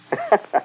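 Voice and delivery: short high-pitched cackle; high mocking laugh. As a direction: laugh at her, high and brief. Colonel laughs at the bargain.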